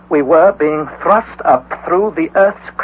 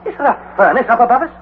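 much higher, urgent; alarmed; 9 semitones higher than usual. Acted. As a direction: panic at boiling water. Panicked nephew.

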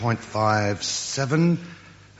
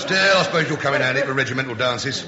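usual speaking voice

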